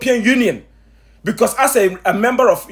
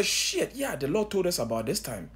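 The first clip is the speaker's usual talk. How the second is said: dropped low and much softer, under the breath; mock dawning realization; 4 semitones lower, 12 dB quieter than usual. Congregation members who ignored the warnings, realizing too late, muttered softly. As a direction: mutter it like someone who finally gets it. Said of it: "you say".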